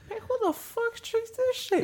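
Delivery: very high, slow re-enacted question; mock disbelief. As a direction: squeak it out slowly, mocking your own blunder. Re-enacts own tactless remark.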